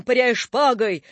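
plain reading voice

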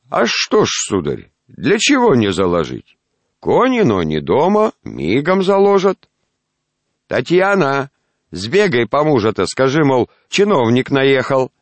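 put-on high, loud old man's voice; bossy old grandfather calling.

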